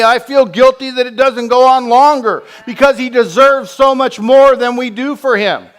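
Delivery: loud, high impassioned preaching; fervent pulpit cry; full-force preaching. Acted. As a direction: preach it out with rising fire.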